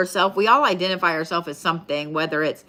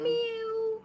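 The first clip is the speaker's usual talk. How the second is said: high, slow whiny cat meow; 6 dB quieter than usual. Retold or imitated. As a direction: mewl like a needy kitten. Imitating cat crying.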